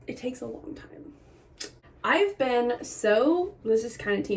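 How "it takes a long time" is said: quiet whisper, trailing off; whispered aside. Real, not acted. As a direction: drop to a whisper. Hushed aside after a joke.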